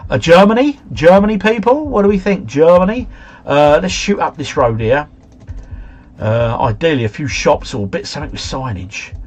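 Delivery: normal talking voice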